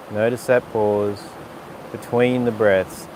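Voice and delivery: calm yoga teacher guiding a breath sequence; guided breathing cues. Acted. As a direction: talk them through each breath gently. Leads breathing practice.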